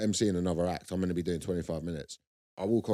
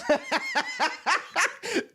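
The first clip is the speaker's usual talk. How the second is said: very high laugh burst; burst of laughter. Real, not acted. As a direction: explode into laughter. Laughing at racist punchline.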